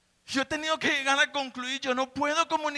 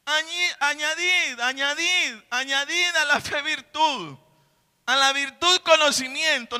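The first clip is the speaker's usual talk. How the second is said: building, louder exhortation; fiery preaching; 3 semitones higher, 4 dB louder than usual. Acted. Preacher urging congregation to add virtue, louder and building. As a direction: drive the exhortation home, rising.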